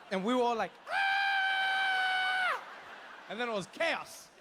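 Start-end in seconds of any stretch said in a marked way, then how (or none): 0.9-2.6 very high, sustained comic shriek; mock crowd scream. Crowd reacting to a hissing snake, mock terrified scream. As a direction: scream on one long high note.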